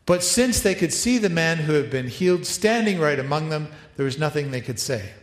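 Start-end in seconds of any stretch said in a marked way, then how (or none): none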